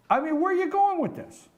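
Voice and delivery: loud, high-pitched exasperation; exasperated. Real, not acted. Host baffled by colleague's questioning, exasperated. Host defends himself against Lonnie.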